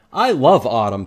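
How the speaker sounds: bright, loud happy Pete voice; Pete's happy line. A narrator's reading. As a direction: read it as a cheerful boy. Boy character voiced in read-aloud.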